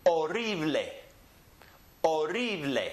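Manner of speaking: horrible as o-RREE-bleh, silent H; Spanish horrible, stress on the RRI; Spanish pronunciation. Shown as a demonstration. Teacher contrasts it with English, horrible as o-RREE-bleh. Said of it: "remember that the H is silent".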